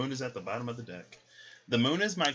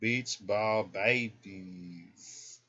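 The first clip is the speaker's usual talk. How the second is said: drawn out; 2 semitones lower, much slower than usual. Real, not acted. Drawn out name.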